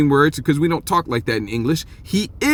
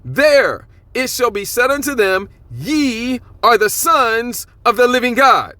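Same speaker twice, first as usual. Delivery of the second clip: slow, higher, solemn reading of the verse; scripture reading; 7 semitones higher, slower than usual. Acted. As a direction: dwell on each phrase as scripture. Reads scripture aloud.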